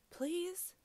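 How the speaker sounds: high, rising plea; pleading. A narrator's reading. Fox begs Guinea Pig for his place, pleading.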